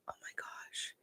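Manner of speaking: barely voiced gasp of delight; breathless whisper. Real, not acted. Vlogger picturing the awkward scene, stunned whisper. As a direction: whisper it in disbelief.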